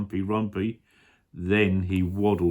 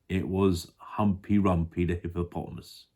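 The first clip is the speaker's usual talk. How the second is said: ordinary delivery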